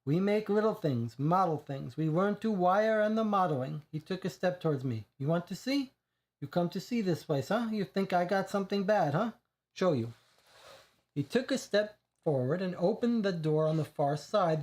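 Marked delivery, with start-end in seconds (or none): 0.0-3.8 lightly voiced Italian immigrant accent, proud; a touch of a broken-English Italian accent. Reggio explains his models. 5.2-10.1 lightly voiced Italian immigrant accent, proud; a touch of a broken-English Italian accent. Reggio invites narrator in.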